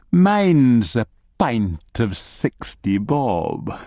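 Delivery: slow, drawn-out gnome voice; gnome character. Acted. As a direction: order leisurely, stretching the words.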